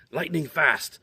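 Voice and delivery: usual speaking voice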